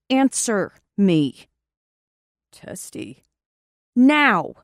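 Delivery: loud, slow stern order; stern demand. Acted. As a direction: order him firmly. Demanding with sword.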